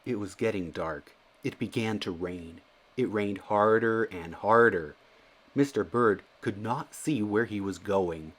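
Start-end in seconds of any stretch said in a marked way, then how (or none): none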